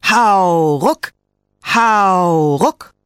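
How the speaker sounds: laut gedehntes „Hau“, hochschnellendes „ruck“; Anfeuerungsruf. A narrator's reading. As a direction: mit aller Kraft zum Ziehen rufen. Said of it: "riefen sie und zogen so feste sie konnten". (kräftiger, lauter Hauruck-Ruf)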